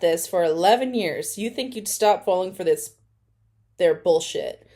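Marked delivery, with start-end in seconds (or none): none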